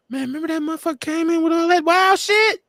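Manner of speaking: high-pitched mock amazement; amazed looking back. Host voicing us looking back one day, high amazed exclamation.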